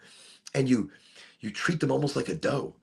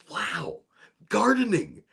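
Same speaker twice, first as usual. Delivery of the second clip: much higher, enthusiastic gushing; excited wonder; 9 semitones higher than usual. Real, not acted. Marvels at what gardening taught them.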